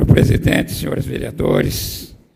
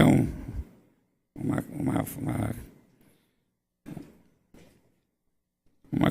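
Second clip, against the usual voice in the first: halting, repeated false starts, trailing softer; stammering; 3 semitones lower, 5 dB quieter than usual. Real, not acted. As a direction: get stuck on the word, with long hesitant gaps. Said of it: "ter gaguejado um pouquinho".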